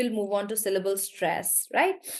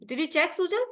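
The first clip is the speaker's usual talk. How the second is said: ordinary delivery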